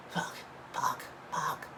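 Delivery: whispered curses, under the breath; panicked whisper. Retold or imitated. Panicking after a car crash.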